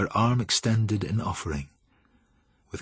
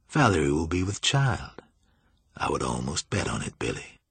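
low, softened voice of a sly master; sly, urbane Julian; 5 semitones lower, 4 dB quieter than usual. A narrator's reading.

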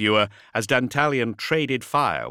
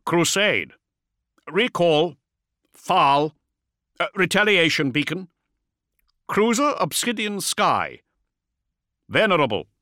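clipped, louder broken vox report; gruff space marine; 9 semitones higher, 5 dB louder than usual. A narrator's reading. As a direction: fragments shouted through static. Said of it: "interference-punished voice of a space marine".

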